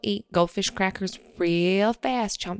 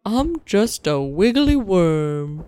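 slow, louder silly worm; worm puppet voice; 2 semitones higher, 5 dB louder, slower than usual. Acted. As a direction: bob along as a cheerful worm. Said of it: "it's a hand puppet".

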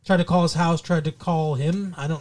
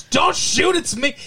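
imitating bad acting; 8 semitones higher than usual. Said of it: "This was not good acting".

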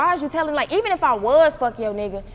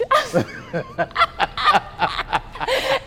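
long, high fit of laughing; laughter. Real, not acted. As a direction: crack up helplessly, high-pitched. Laughs at the retold text.

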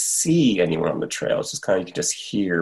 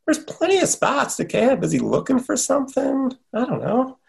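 anxious inner voice. Retold or imitated. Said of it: "I'm like".

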